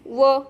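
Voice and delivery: व as an isolated "vuh"; phonic V sound. Shown as a demonstration. Teacher showing the sound of V, व as "vuh". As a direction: sound out व, not the letter name. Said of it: "व्हीचा उच्चार होतो".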